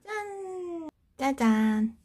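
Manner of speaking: cheerful reveal of the finished rack. Real, not acted. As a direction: present the result with a little flourish. Presenting the hung hats.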